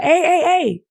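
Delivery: high, loud call across the yard; cousins calling out. Retold or imitated. Cousins call him from the backyard ring, a high loud shout. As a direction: yell for him like a kid calling a friend out to play. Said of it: "they used to call me like".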